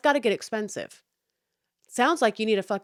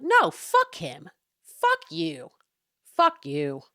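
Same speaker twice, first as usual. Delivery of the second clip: angry cursing; 5 dB louder, much slower than usual. Retold or imitated. Retold angry reply to husband.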